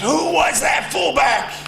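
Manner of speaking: high shouting coach voice, slow and loud; Bo impersonation. Retold or imitated. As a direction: bark it like an old furious coach. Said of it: "That's my best Bo impersonation".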